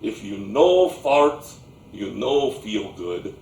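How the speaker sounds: put-on slow Slavic-accented grandpa, starting loud and dropping low; remembered Slavic accent. Grown grandchild remembering grandpa's advice, slow Slavic accent. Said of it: "I can still hear his voice".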